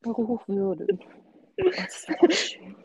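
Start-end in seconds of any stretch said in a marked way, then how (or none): 1.6-2.8 whispered. Real, not acted. Dreamy aside about formal address.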